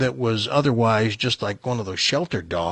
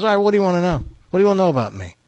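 lightly voiced dog, higher and businesslike; higher pitch, inviting questions; talking dog; 6 semitones higher, 3 dB louder, much faster than usual. Dog invites the buyer's questions, lightly voiced talking dog. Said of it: "He goes".

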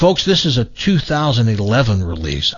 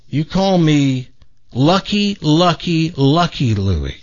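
slow, measured acted declaration; drawn-out punchline; 4 semitones higher, slower than usual. Louie corrects his buddy's name, slowly and emphatically. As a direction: space out each word for the punchline.

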